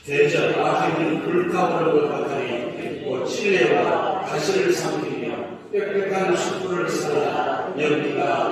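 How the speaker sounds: unison scripture reading. Acted. Pastor reading Isaiah in unison with the congregation, loud sing-song chant. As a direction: chant the verses together with the church.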